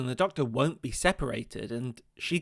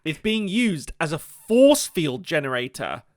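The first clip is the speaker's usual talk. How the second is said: usual speaking voice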